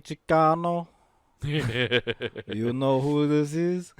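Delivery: put-on slow, low Cuban accent. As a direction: lean into a Razor Ramon drawl. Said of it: "talking like a Cuban".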